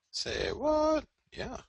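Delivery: very high, squealed disbelief; squeaky mock surprise. Mocking his own chart call in a squeaky voice. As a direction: squeak it like a startled cartoon.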